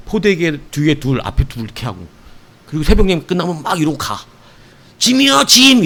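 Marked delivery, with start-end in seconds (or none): 5.0-5.8 high loud call 짐이요, 짐; calling out to clear way. Retold or imitated. Mother with babies clearing crowd, loud high call. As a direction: shout for people to move aside. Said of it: "앞에 가는 사람들한테 비키라고".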